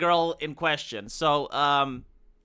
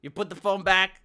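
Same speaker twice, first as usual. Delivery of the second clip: ordinary delivery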